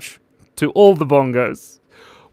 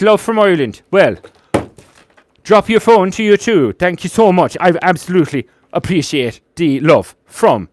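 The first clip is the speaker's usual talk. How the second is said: put-on loud, higher-pitched, angry-sounding Irish accent; 6 semitones higher, 7 dB louder than usual. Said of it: "The Irish accent always comes out a bit angry".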